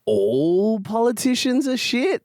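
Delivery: mock crowd-pleaser. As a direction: drag it out high, testing the room. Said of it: "I'll go".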